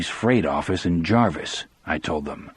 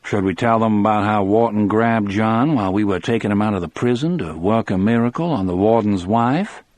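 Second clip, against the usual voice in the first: usual speaking voice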